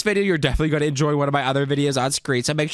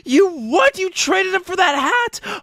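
much higher, outraged squeal; can't believe what he just heard; hammed-up shock at the little brother, high; shocked disbelief; 12 semitones higher, slower than usual. Brother traded Blippi away.

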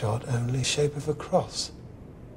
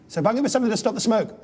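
panicked rush; 10 semitones higher, 3 dB louder, much faster than usual. Acted. Panic in burning tower.

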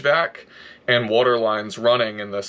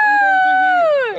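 very high silly voice; squeaky excitement; 3 dB louder than usual. Acted. Announcing heater progress to his wife, squeaky high. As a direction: squeal it out like a cartoon.

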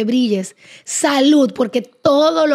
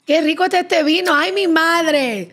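drawn-out, slow cry of delight; delighted exclamation; 5 semitones higher than usual. Real, not acted. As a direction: savour it, stretching every word. Tasting the wine, delighted.

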